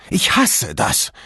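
slow, rough raspy voice. A narrator's reading. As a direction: rasp the words out slowly. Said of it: "sagte sie mit rauer Stimme".

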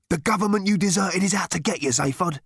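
fast, high urgent hiss. A narrator's reading. Man warning Zaphod he is being hunted, rapid hiss. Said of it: "hissed the man".

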